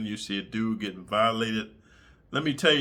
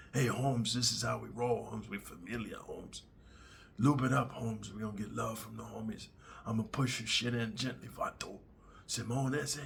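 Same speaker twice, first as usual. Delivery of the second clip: softer, half-whispered Chicano coaxing; 2 semitones lower, 9 dB quieter than usual. Retold or imitated. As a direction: coax him gently like a gang member. Mexican inmates persuading gently.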